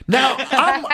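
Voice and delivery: ordinary delivery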